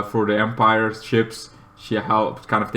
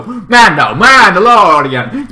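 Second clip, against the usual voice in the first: calling out; 8 semitones higher, 9 dB louder, slower than usual. Retold or imitated. Calling to the Mandalorian.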